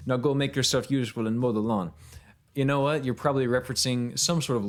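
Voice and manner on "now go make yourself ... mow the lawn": put-on Jamaican accent, scolding; playful Jamaican-accented telling-off. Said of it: "I don't know why she's Jamaican too now".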